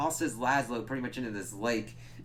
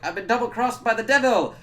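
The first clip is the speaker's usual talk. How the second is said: high, loud hammed-up wail of betrayal; mock vampire dramatics; 11 semitones higher, 9 dB louder than usual. Vlogger imitating Laszlo's outrage at the devil, loud and high. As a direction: belt it like a betrayed old vampire. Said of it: "his pronunciation's fucking on point".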